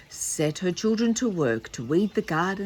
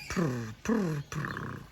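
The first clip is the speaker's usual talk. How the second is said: purring; 7 dB quieter, much slower than usual. Shown as a demonstration. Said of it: "I did it in a purr voice".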